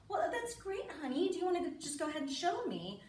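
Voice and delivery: high, sweet praise; encouraging parent. Retold or imitated. Mother asking daughter to show swimming, high and encouraging. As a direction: coo encouragement as to a small child.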